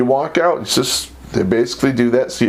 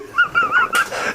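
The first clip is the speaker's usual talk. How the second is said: high burst of laughter. Real, not acted. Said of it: "He was laughing so hard".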